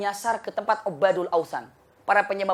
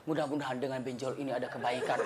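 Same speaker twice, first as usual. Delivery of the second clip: lower, much softer, laughing reply; calm wife; 7 semitones lower, 11 dB quieter than usual. Acted. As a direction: say it dryly under your breath.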